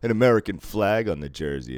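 put-on Canadian accent. Canadian fans teasing him about a jersey, imitated Canadian accent. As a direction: challenge it in a mock Canadian accent. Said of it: "they're like".

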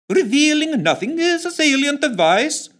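high, loud, strange warbling voice; a weird voice that keeps shifting; odd shifting tones. Acted. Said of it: "in his odd shifting tones".

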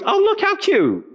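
high cooing voice; cooing lady. Retold or imitated. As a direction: gush over a sweet kid at the door. Wife coos at the boy.

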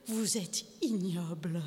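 slow, soft, low disgust; quiet, slow contempt; contemptuous verdict. A narrator's reading. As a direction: drop down and draw out IGNOBLE. Wife condemns husband, first time.

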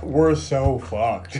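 low, slow doomed groan; despairing. Retold or imitated. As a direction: drag it out in dread. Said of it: "Dad's like".

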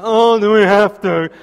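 hammed-up reluctant little boy; whining child. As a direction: imitate kids dragging out a protest. Acted boys protesting toothbrushing.

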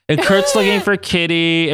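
ordinary talk, nothing marked

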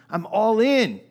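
loud excited vow; fervent shout. Retold or imitated. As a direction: shout the pledge high and loud. Peter pledging loyalty, repeated louder.

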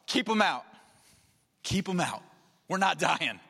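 scared disciples begging to keep them out; mock panic. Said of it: "You can see the fear".

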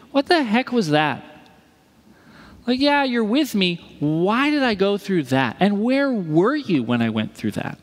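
high-pitched retold protest; wrestling with God. Imitating new father questioning God after hard birth, indignant. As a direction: demand answers, voice lifted in frustration. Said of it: "Wrestles with God".

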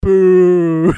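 loud drawn-out boo; crowd boo. Retold or imitated.

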